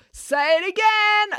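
high, drawn-out hype-man shout; urging. A narrator's reading. Brad to the chanting crowd, urging. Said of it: "urged Brad".

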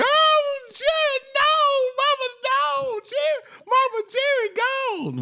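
very high-pitched, drawn-out fake wail; hammed-up bereaved howl for a friend; mock wailing grief. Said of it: "Would, would it be like that?".

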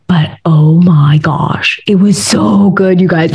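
slow, drawn-out rave over the soup; savouring. Real, not acted. Telling viewers about Iceland soup, slow savouring rave. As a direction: stretch it out like tasting it again.

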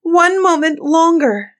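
high, loud plea for delay; pleading wife. A narrator's reading. Doomed wife begging Bluebeard for time, pleading.

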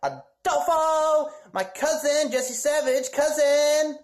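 high, slow put-on girly voice; Marisol impression. Introducing his cousin as Marisol in a high put-on voice. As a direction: drawl it out like a sitcom teen. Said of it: "Sounded like Marisol".

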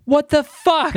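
mock-shocked, high-pitched blurt; imitated shocked dad. Dad catches daughter fighting and drinking, high mock shock. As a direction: act out a stunned father's reaction, pitched well up. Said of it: "And he's like".

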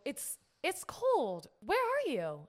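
drawn-out, high-pitched plea; mild plaintive call into the night; retold calling out. Camper peeking out of tent to boyfriend, whiny call.

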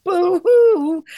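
loud, high-pitched mock wail; mock crying. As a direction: wail a sarcastic boo-hoo. Mock crying over hard work.

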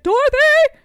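loud high shout of a name; calling out. Retold or imitated. Uncle Henry finding lost Dorothy, loud high shout. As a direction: yell her name across the field. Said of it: "He's like".

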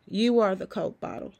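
ordinary delivery

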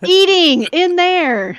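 high, drawn-out loud shouting; yelling back. Acted. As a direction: yell it slowly, then shriek the last word. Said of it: "He yells back".

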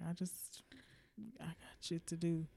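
whispered and soft; whispered brush-off. Retold or imitated. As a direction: mutter it under your breath, wanting out. Muttering excuse to unwanted guy.

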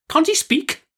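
high-pitched exasperated demand; exasperated aunt, voice pitched high; impatient cry. A narrator's reading. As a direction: demand it with no patience left. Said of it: "cried my aunt impatiently".

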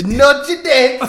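mimicking Siri's translation. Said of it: "Wait, what is that?".

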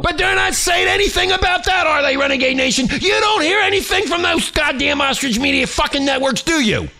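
high, heated outburst; angry yelling. Real, not acted. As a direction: yell it in indignation. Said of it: "I'm sorry I'm yelling".

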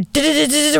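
a quick rising du-du-du, like an alarm; alarm noise. Retold or imitated. Recounting the cafe alarm to listeners, mock siren du-du-du. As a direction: mimic the siren sounding in the cafe.

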